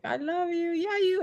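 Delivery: put-on high, sing-song baby talk. Shown as a demonstration. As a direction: coo it like a little kid. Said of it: "It's like".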